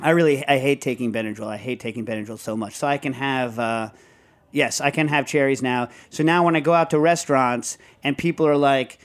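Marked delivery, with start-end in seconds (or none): none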